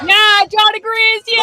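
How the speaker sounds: high, loud mock cheer; mock shout. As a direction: yell it like a rally crowd. Mocking the rally chant.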